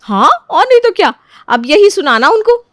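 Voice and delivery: high and loud, pushing her on; egging the new bride on, high and loud; eager insistence. Acted. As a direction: insist brightly, overselling it. Sister-in-law insisting with fake praise.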